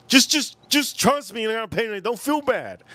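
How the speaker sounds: high-pitched, pleading insistence; urgent high reassurance, acted out; insistent friend. Retold friend insisting he not pay.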